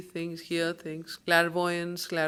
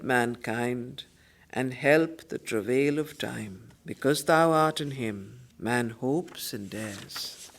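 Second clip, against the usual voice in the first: lower, fuller voice addressing a goddess; solemn invocation; 5 semitones lower, 4 dB louder than usual. Acted. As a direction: invoke the Mother with reverent force. Savitri's address read aloud.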